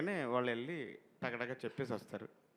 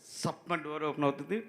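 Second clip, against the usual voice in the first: usual speaking voice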